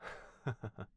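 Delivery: a quiet, bashful chuckle; soft laugh. Acted. Butler chuckles after praising his master, bashful soft laugh. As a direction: laugh softly at yourself. Embarrassed after sincere compliments.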